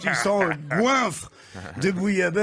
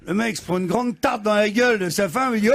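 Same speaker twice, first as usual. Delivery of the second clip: ordinary talk, nothing marked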